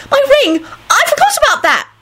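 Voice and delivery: very high, delighted squeal; joyful squeal. A narrator's reading. Rosie remembering her magic ring, squealing joyfully. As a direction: squeal with sudden glee. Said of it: "she squealed joyfully".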